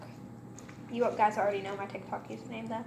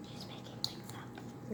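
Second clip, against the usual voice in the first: whisper; 14 dB quieter than usual. Real, not acted. Aside to camera about friend.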